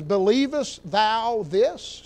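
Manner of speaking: slow, weighty question; Jesus asking, slow and searching; solemn question. Acted. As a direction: let each word land slowly. Jesus's question, read slowly.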